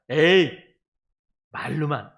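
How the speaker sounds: a short mocking "eh, just talk"; scoffing dismissal. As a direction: brush it off with a scornful sneer. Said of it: "이렇게 된단 말이에요". (비웃듯 "에이, 말로만")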